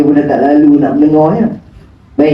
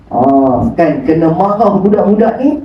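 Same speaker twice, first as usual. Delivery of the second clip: usual speaking voice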